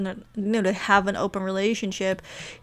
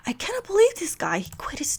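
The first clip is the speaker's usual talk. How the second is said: whispered, higher and fed up; 10 semitones higher than usual. Retold or imitated. Wife secretly venting about her husband, whispered. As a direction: hiss it out under your breath.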